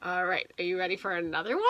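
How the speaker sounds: usual speaking voice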